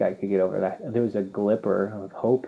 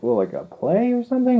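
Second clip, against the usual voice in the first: much higher, puzzled officer voice; puzzled female officer; 5 dB louder than usual. Retold or imitated. Female customs officer asks about the theater in a high, baffled voice.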